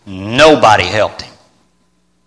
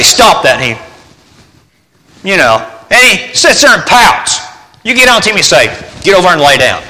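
mock dog scolding; 8 semitones higher, 4 dB louder than usual. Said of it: "you get out there and say".

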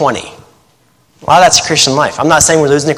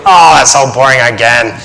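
mock bored whine; 3 semitones lower than usual. Mock Bible reader giving up.

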